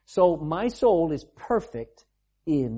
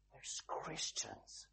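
slow, breathy whisper of the answer; whispered punchline; 21 dB quieter, much slower than usual. Real, not acted. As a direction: let it drop to a hushed whisper. Punchline after listing divisions.

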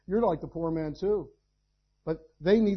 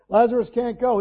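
louder, higher Lazarus can't go; emphatic repeat; 6 semitones higher, 10 dB louder than usual. Real, not acted. Preacher repeating to the congregation, louder and higher. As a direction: hammer home Lazarus can't go.

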